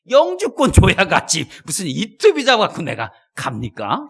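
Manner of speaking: loud, drawn-out acted protest. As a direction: push back loudly and slowly. Acts his refusal to God.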